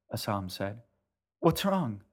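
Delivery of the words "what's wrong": alarmed. Acted. Boy alarmed.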